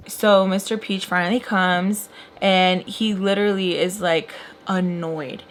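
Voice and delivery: usual speaking voice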